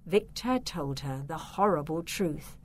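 ordinary delivery, nothing marked

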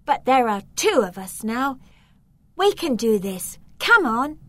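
put-on girl urging the boy to escape, determined. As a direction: spur him on.

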